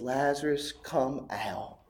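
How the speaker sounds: a soft, unvoiced call into the tomb; hushed whisper. Jesus calls Lazarus from tomb.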